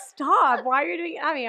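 mock upset protest. Imagining being thrown up on in bed, hammed-up upset. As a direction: hit "stop" hard and high, then complain. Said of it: "I'd be so upset".

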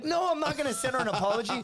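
high, protesting cousin; mock indignation; retold indignant refusal.

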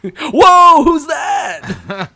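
high startled shout; surprised shout. Real, not acted. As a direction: yelp in surprise at the voice. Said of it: "that ghost voice you just heard".

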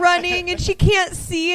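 ordinary delivery

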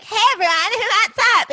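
high, loud cheery greeting; chirpy Jenny impression. Friend mimics Jenny greeting classmates, high and cheery. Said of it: "she's like".

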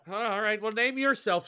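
high, loud watchman's voice; guard's challenge. Acted.

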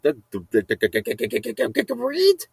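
rapid stutter into high squeal; stammer, then squeak upward like a question; comic stunned squawk. Acted. Comic stunned reaction instead of "what.

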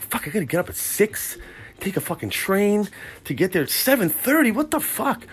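high-pitched grumble at an early start; exasperated complaint. Retold or imitated. Dreading an early start in retold thought.

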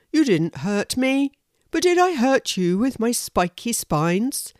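a friendly hedgehog checking on someone; concerned hedgehog. Acted. Horace asks the squirrel if he hurt her, lightly voiced concern.

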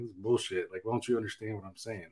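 grumbling. Retold or imitated. Walking away from wife, quiet sulky grumble. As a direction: grumble like a sulking kid. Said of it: "I'm like".

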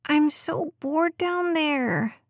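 put-on high, slow whiny little girl groan; groaning bored child. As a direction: drag it out like a bored kid. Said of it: "Des groaned".

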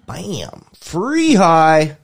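high, loud mock cheer on free high; a bit of hammed-up glee; mock excited. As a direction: play up the thrill of a free score. Jokes about finding magic mushrooms.

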